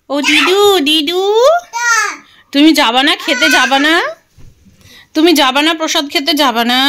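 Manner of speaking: loud, high calls across the house; calling out. Real, not acted. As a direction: call grandma from afar, raising the voice. Calling grandma to the feast.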